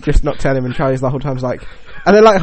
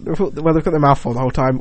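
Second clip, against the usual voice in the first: ordinary delivery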